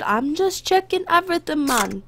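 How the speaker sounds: mock Jamaican accent, lightly done; jokey Jamaican-accented border guard. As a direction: drift into a playful Jamaican lilt. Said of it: "I don't know why I went to Jamaican".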